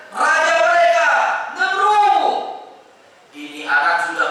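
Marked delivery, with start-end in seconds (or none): 0.1-2.4 very high, forceful call; rousing preacher's cry. Real, not acted. Preacher naming who shouted at Ibrahim, high cry. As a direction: cry out the king's identity. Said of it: "Maka saat itu teriak".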